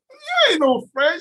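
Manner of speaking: ordinary delivery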